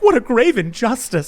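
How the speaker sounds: high, hammed-up indignation; mocking the heroine's outrage at her stepdad; mock outraged girl. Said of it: "And she was like".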